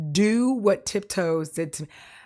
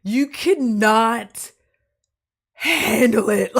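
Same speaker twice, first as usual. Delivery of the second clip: slow, louder, every word punched; emphatic; 4 semitones higher, 7 dB louder, much slower than usual. Real, not acted. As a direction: drag out "You cannot handle it". Ranting about the movie.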